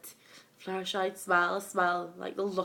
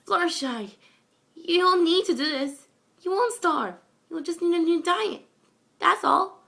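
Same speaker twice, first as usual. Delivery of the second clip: high, loud pleading exclamation; filly begging a monster to spare her, loud and high; desperate begging; 11 semitones higher, 8 dB louder than usual. Acted. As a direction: beg for your life at the top of your voice. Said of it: "Babsy exclaimed, begging for her life".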